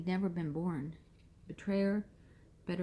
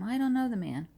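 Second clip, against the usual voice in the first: higher and louder, swearing it; cursing denial; 5 semitones higher, 5 dB louder than usual. Acted. Peter swearing to the bystanders, loud angry denial. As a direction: spit it out with an oath. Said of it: "Then he began to call down curses".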